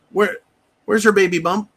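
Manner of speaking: ordinary talk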